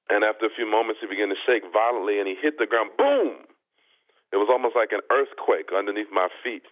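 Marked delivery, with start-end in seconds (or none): 3.0-3.5 mimicked boom. Telling the host how the man hit the floor, sharp boom. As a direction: burst out the crash like an earthquake.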